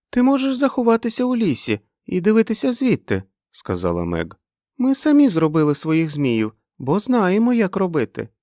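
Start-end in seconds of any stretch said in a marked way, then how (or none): 0.1-3.2 put-on high-pitched child; very high childish pitch; little girl's voice. Narrator voices little girl Meg. 4.8-8.3 put-on high, loud eager kid; high-pitched childish voice; child's voice. Narrator voices the children explaining.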